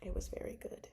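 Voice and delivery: soft whispered verdict. Real, not acted. Telling viewers how much she loved the book, whispering. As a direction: drop to a hush as if sharing a secret.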